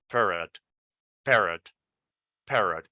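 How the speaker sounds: "parrot" with a reduced second vowel, low and falling; correct parrot. Shown as a demonstration. Teacher modelling parrot for learners, careful pronunciation. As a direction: say "parrot" with "uh" in place of the o. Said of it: "we are saying e".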